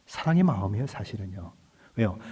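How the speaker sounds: normal talking voice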